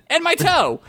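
very high squawk of protest; indignant shout. A narrator's reading. Skeleton protests his stolen toe, shouting high. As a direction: pitch it way up, topping the last protest. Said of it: "he shouted".

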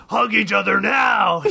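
high shouted demon command. A narrator's reading. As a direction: order them about at a shout. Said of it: "commanded the demon".

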